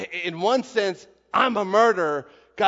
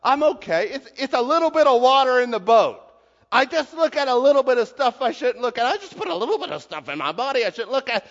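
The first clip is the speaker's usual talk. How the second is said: breezy, laughing shrug; mock self-excuse; 5 semitones higher, 4 dB louder than usual. A sinner brushing off his sin, breezy and laughing. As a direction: brush it off with a chuckle. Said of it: "we say".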